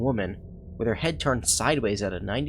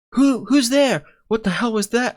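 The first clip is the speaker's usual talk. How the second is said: high, loud frightened yell; startled shout; 13 semitones higher, 8 dB louder than usual. Real, not acted. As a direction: jump and shout in alarm. Startled by a noise in game.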